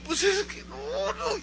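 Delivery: put-on very high, reluctant child voice; acted pleading kid; ashamed little boy. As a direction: squirm out of confessing. Child too ashamed to confess.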